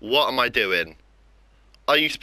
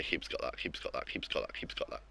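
fading mutter; 10 semitones lower, 15 dB quieter than usual. Real, not acted. Muttering as keeper saves.

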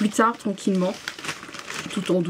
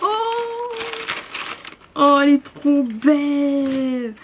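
delighted; 6 semitones higher than usual. Real, not acted. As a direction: marvel at the mug. Découvre le mug. (émerveillée)

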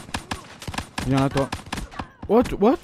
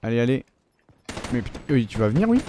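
ordinary delivery

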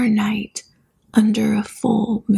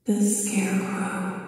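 soft, distant, eerie voice; possessed father; 3 semitones lower, 7 dB quieter than usual. A narrator's reading. Possessed father naming the master to his son, eerie and soft. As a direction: answer softly as if entranced. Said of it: "his father said".

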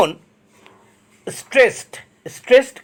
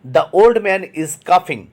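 very slow, word by word; slow dictation reading; much slower than usual. Shown as a demonstration. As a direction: read it out slowly for the class to copy. Teacher reads example sentence aloud. (ধীরে ধীরে শ্রুতলিখনের মতো পড়া)

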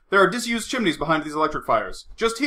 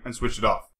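plain reading voice

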